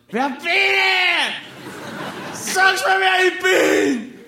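put-on very high, slow drunken yelling; drunken shout. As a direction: scream it into the night, drunk. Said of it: "hab geschrien". (sehr hoch, langsam, betrunken geschrien)

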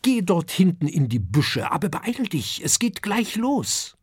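a touch of parental impatience; mother answering her son, mildly impatient. Acted.

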